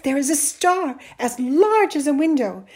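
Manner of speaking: high, loud wonder; excited. A narrator's reading. Boy describes the star to mother, excited. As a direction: marvel aloud like a thrilled boy. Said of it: "talking excitedly".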